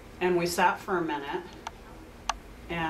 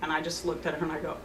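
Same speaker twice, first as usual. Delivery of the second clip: usual speaking voice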